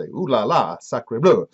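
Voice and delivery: hammed-up French investor; French accent. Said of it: "He's gonna say".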